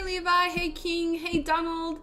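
ordinary delivery

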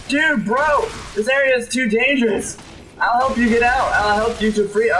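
high urgent call to the stranger. Real, not acted. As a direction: shout to reach the trapped guy. Calling to a trapped human.

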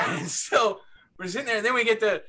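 normal talking voice

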